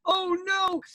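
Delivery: high-pitched, drawn-out cry of dismay; hammed-up damsel in distress; alarmed little girl. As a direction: squeal it in storybook alarm. Frightened girl in a story.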